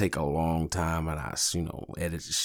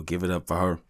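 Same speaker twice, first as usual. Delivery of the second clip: ordinary talk, nothing marked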